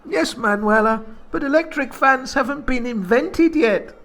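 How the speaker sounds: put-on flirty Spanish accent. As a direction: tease her playfully. [name] jokes in high accent.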